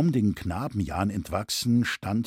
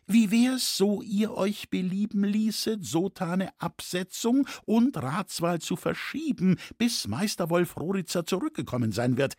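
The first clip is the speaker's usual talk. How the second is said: higher-pitched, politely persuasive character voice; placating old master Loy; 7 semitones higher than usual. Acted. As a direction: talk the crowd round with formal courtesy. Loy placates the excited crowd. (höflich beschwichtigend, mit höherer Stimme)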